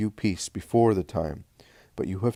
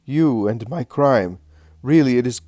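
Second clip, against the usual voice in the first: high, loud cry of terror; terrorized; 4 semitones higher, 8 dB louder than usual. A narrator's reading. Man confronted with his own crime, terrorized. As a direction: build to a frantic, cornered shout. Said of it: "The man is terrorized".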